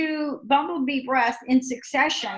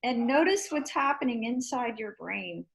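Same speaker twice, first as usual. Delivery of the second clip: guided meditation; 5 dB quieter than usual. Acted. Guiding quiet after breathing.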